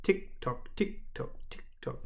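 soft, whispery tick-tock; hushed rhythmic ticking; clock ticking. A narrator's reading. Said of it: "familiar sound of ticking".

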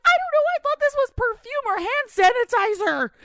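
put-on very high kid voice, protesting; imitating panicked son. Mom mimicking son explaining he thought it was perfume, panicked. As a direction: plead your innocence in a squeaky child's pitch. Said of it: "He's freaking out".

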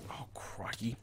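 whispered "oh, crikey"; whispered alarm. Real, not acted. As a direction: breathe it out in a whisper. Sudden danger under breath.

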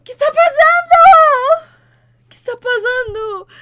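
high, slow, full-throated screaming; hammed-up scream. Streamer shrieking at the shocking twist, slow high scream. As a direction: shriek it in mock panic.

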